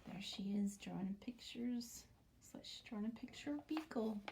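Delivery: hushed whisper. Pretend. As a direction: whisper as if peeking in.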